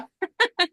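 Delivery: high, short laugh; amused laugh. Real, not acted. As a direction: laugh off the background noise. Laughs about kids crying in background.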